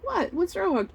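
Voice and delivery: high-pitched feigned puzzlement; mock-innocent dad. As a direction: imitate dad playing innocent after a chuckle. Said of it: "And he'd be like".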